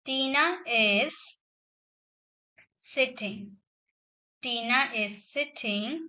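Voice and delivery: slow, word-by-word dictation with long pauses; a teacher dictating each word slowly. Shown as a demonstration. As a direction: read it out slowly so students can write it down. Teacher dictates example sentence.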